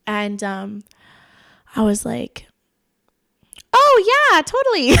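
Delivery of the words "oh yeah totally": high, loud mock enthusiasm; over-keen high-pitched agreement, put on; playing along. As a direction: gush along with exaggerated eagerness. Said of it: "just playing the role".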